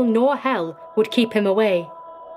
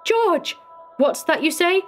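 very high, alarmed outburst; frightened cry; 11 semitones higher than usual. A narrator's reading. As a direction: call out in dread and disbelief. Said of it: "more than human fright".

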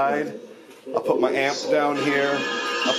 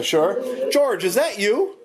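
higher, eager call to spirits; raised calling voice; 9 semitones higher, slower than usual. Real, not acted. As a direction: call out as if someone might hear. Echoes the spirit box answer.

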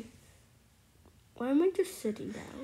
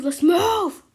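higher, louder urgent shout; shouted command; 5 semitones higher, 11 dB louder than usual. Real, not acted. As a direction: yell it to get everyone going. Urging again, louder.

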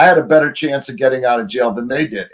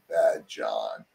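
much lower and much softer, a dark murmur; ominous cellmate; 9 semitones lower, 11 dB quieter than usual. Retold or imitated. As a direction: drop the voice low and quiet like a menace. Cellmate naming his third personality.

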